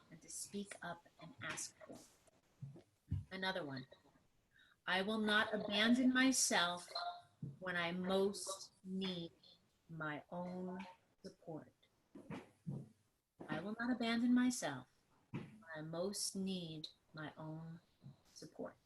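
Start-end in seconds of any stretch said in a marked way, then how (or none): 0.1-2.8 breathy whispered close; soft whispered affirmation. Real, not acted. Mantra ends in whisper. 4.9-18.7 slow, deliberate affirmation read; measured, heartfelt mantra. Acted. Reads mantra slowly.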